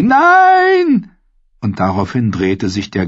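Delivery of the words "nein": very high, loud desperate cry; scream. Acted. Sam screams to draw the wight from Goldie, desperate scream. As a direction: shout to pull it away from her. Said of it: "Doch Sam schrie".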